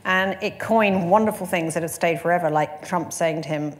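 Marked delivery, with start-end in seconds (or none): none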